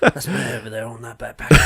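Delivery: hushed English action-hero impression; Jason Statham. Imagined boast to other parents, hushed action-star impression. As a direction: deliver it as a grave tough-guy trailer line. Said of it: "Do your best, Jason Statham".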